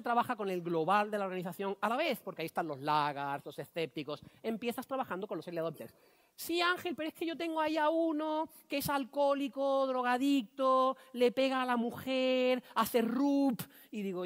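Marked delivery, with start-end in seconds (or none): 6.3-13.6 high, drawn-out listing complaint; imitated whining objector. Objector complaining to the coach about one employee, imitated whine. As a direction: pile up grievances in a raised mock voice.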